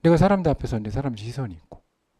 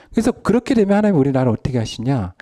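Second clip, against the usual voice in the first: ordinary talk, nothing marked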